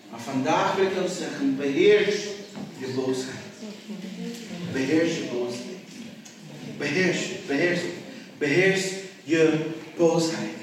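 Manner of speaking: low, soft exhortation with long pauses; solemn preaching. Acted. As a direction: urge them quietly, letting each phrase settle.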